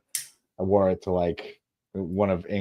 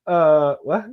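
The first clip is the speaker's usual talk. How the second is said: higher, louder startled reply; mock caught-out kid; 7 semitones higher, 6 dB louder than usual. Kid answering dad in the story, mock startled. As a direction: imitate a kid put on the spot.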